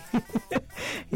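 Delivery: soft breathy chuckle; real laughter. Real, not acted. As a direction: chuckle helplessly. Laughs waiting for Michael.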